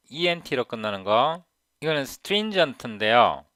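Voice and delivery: ordinary talk, nothing marked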